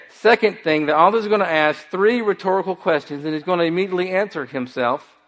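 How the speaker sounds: ordinary delivery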